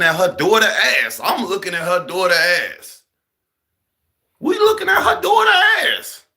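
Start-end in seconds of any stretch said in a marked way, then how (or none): 4.4-6.2 comic shout. Acted. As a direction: blurt it out at full volume. Punchline shout.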